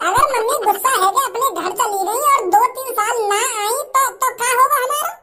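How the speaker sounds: put-on a kid earnestly advising his parents; lecturing child. (समझाता हुआ बच्चा, ऊँची आवाज़)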